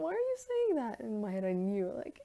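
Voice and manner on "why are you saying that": very high, incredulous why; shocked question. Retold or imitated. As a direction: squeak it out in disbelief. Shocked at instructor's pessimism.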